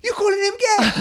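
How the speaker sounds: high laughing Glaswegian challenge; Glaswegian accent. Retold or imitated. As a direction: threaten him in Glaswegian. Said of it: "commit to the accent because it's a thing of beauty".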